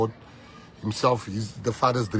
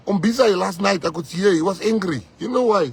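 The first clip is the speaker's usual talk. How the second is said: high, loud passionate speech; fiery defence; 8 semitones higher, 7 dB louder than usual. Real, not acted. Defending Umbiza to the crowd, fiery loud speech. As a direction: defend him with fire.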